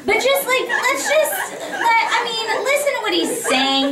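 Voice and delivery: very high, laughing plea; squeaky, insistent bargaining through giggles; pleading. Retold or imitated. Actor still begging director on the frog's behalf, high laughing plea.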